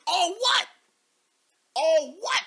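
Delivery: high, loud "All what?" in disbelief; baffled. Real, not acted. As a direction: ask it like the sentence makes no sense. Mock confusion at the post.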